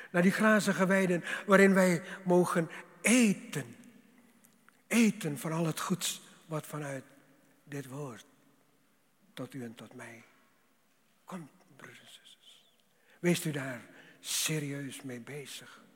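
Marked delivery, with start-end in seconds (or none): 0.1-3.8 loud, ringing pulpit swell; louder fervent sermon voice; fervent preaching. Acted. 11.2-12.7 hushed, breathy close; whispered sermon ending; whispered aside. Acted. Sermon line dropped to whisper.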